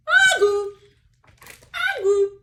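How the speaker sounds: loud, high honking agu; bagpipe honk. Acted. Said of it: "And the bagpipe said".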